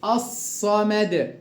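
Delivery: الصمد slowly with a long emphatic double s; lam merged into s. Shown as a demonstration. Teacher shows the merging, slow doubled emphatic s. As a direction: hold the ص in الصمد, lam silent. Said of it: "أضغمت اللام في الصاد".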